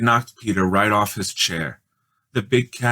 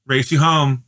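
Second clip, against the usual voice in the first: higher, louder playful challenge; excited dare; 6 semitones higher, 5 dB louder than usual. A narrator's reading. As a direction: call it out as she dashes off. Judy challenges, runs off.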